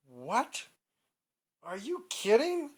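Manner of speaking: high, loud mock disbelief; incredulous grandpa. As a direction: play a grandpa who can't believe his ears. Startled grandpa hears the news.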